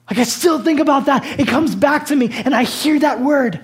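high, loud, impassioned sermon delivery; full-force preaching. Real, not acted.

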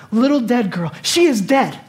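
acted insistent protest; emphatic insistence. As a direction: hammer home the plain fact. Insisting the girl is dead.

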